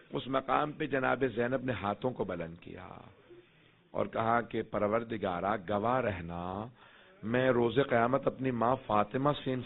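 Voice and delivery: low, soft grieving recitation; hushed lament of the sermon's close; mournful lament. Acted. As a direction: let the voice sink into quiet mourning.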